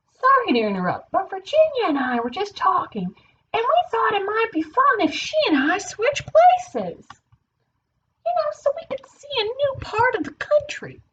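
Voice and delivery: high-pitched, polite character voice; polite Idaho. Acted. Idaho proposing a swap to the party, high polite voice. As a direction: float it high, politely suggesting. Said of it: "Idaho said politely".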